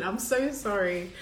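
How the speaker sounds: ordinary talk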